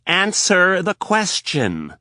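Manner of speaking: high, loud, slow command; stern demand. Acted. Anna insisting Rapp answer, loud and slow. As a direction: push each word.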